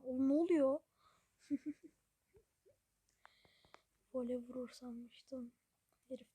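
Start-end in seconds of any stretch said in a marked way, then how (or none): 0.0-0.8 loud fed-up yell at the players; exasperated shout. Real, not acted. Gamer yelling at his own players in frustration. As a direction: bark it at the screen in disbelief.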